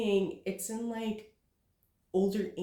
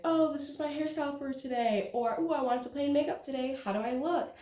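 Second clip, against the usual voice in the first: 1 lightly voiced bubbly influencer; 7 semitones higher than usual. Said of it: "they're like".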